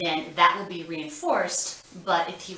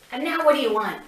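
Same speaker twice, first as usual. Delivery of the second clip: upbeat prompt; 7 semitones higher, 8 dB louder, much faster than usual. Acted. Therapist prompting child.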